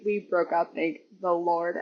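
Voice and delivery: ordinary talk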